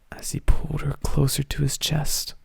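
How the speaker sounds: hushed, breathy reading; whispering. Acted. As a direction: drop to a sultry whisper. Reading a steamy moment.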